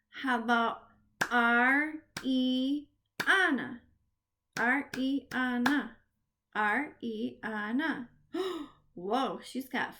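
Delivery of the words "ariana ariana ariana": every syllable of Ariana set apart. Shown as a demonstration. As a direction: clap out Ariana in four separate beats. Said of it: "we are gonna clap the syllable".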